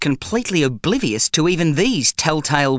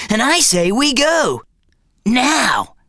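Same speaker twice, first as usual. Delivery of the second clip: bossy bum; 9 semitones higher, slower than usual. A narrator's reading. Bum insisting.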